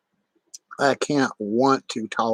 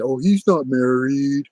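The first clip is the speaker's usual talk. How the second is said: louder, sneering mimic of the accusers; mocking the doubters; 2 semitones higher, 6 dB louder than usual. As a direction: parrot their claims with scorn. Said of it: "They say".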